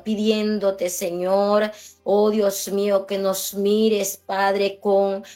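ordinary delivery

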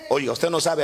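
usual speaking voice

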